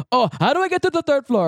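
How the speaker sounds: put-on high, anxious Indian-accented question; imitated Indian accent. Job seeker asking for directions, imitated Indian accent. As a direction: ask it as a flustered man, Indian accent. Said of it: "I'll do the voice".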